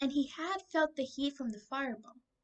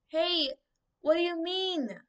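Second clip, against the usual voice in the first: high, loud alarmed yell; boy yelling after the departing soldier; 7 semitones higher, 5 dB louder than usual. A narrator's reading. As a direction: shout after him, pitched up. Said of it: "Drake yelled".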